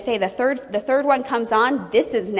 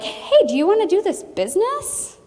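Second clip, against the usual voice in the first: high, bright ask; friendly eager pitch; high, cheery business invitation; upbeat invite; 9 semitones higher than usual. Retold or imitated. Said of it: "they're like".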